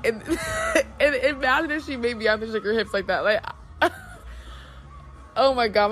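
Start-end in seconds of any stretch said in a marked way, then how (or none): 0.3-0.9 sudden high laugh; high burst of laughter. Real, not acted. Laughing at [name] idea. 3.5-5.3 high airy laugh; breathy giggle fit; breathless laugh. Real, not acted. Laughing at the thought.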